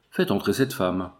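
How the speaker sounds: plain reading voice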